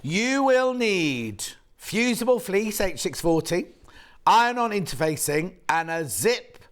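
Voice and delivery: slow, deliberate read-out of the kit list; reading out slowly. Shown as a demonstration. Presenter reading the kit requirements to viewers, slow and deliberate. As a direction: spell the list out word by word, slowly. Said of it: "I'll read it out of a different one".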